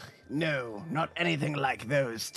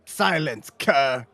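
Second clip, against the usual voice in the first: haughty command; 7 dB louder, slower than usual. Acted. Arrogant fire noble snaps.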